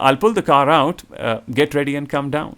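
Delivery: brisk, offhand instruction; casual and crisp. Shown as a demonstration. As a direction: toss it off quickly and breezily. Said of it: "Or a more casual and crisper one". (लापरवाह, चुस्त अंदाज़ में)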